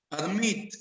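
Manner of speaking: "Admit" as a model word, second syllable stressed; stress on last syllable. Shown as a demonstration. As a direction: Admit with the weight on "-mit". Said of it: "la fuerza lo lleva en la última".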